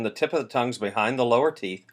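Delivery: normal talking voice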